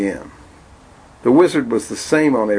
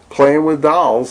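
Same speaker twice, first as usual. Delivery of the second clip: sneering father; 6 semitones higher, 4 dB louder, slower than usual. Acted.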